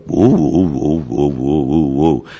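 imitating a dog vocalizing woo, woo; dog howl answering its owner; dog's woo-woo reply. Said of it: "And Blackie would say".

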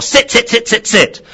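high, loud rapid-fire yelling; screamed command. Acted. Owner shouting at attacking dog, frantic screamed command. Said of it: "if she had screamed".

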